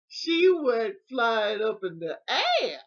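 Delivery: slow, loud, drawn-out storytelling climax; big comic tall-tale delivery; loud exclaiming. Real, not acted. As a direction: belt it out, stretching the key words. Hammed-up climax of funny story.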